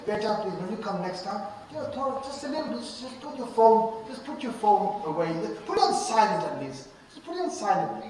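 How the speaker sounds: gentle and kind. Shown as a demonstration. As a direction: ask him kindly, as to a son. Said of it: "Tell him".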